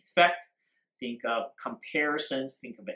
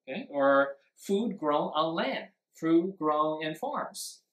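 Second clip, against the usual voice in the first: usual speaking voice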